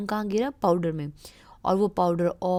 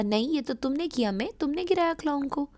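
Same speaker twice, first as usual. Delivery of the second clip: higher, fast angry owl voice; 6 semitones higher, much faster than usual. Acted. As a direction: scold May as the angry owl. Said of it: "owl ने गुस्से में कहा".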